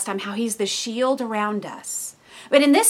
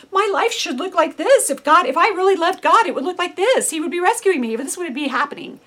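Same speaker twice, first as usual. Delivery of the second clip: higher, insistent, indignant demand; demanding inner voice; 6 semitones higher, 4 dB louder than usual. Retold or imitated. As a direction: insist on how it should be. Her own demanding inner voice.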